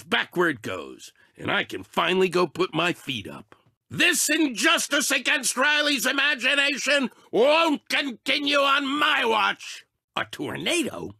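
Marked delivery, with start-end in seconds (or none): 3.9-9.8 heroic declaration. Acted. Heroic vow to protect imagination.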